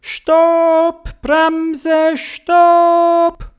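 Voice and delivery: very high, drawn-out braking calls; urgent shouting. A narrator's reading. Theobald sees a big street with cars, urgent high shout. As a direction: call out each word on a held high note. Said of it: "Und der Theobald hat gerufen".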